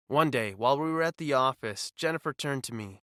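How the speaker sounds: ordinary delivery, nothing marked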